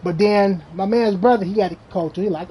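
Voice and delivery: usual speaking voice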